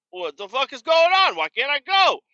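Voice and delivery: high, loud yell as a baffled dog; indignant stuck dog. Acted. Dog on wrong side of the mailbox, indignant yelling. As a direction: voice a frustrated dog straining on its leash.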